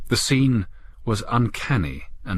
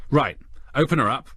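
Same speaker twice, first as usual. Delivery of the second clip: directing; 6 semitones higher than usual. Acted. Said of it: "he directed".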